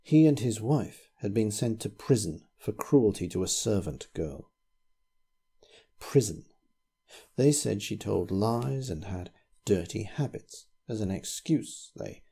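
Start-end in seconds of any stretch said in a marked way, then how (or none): none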